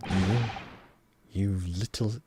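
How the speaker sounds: put-on slow, heavy Russian accent; mock Russian sailor insult.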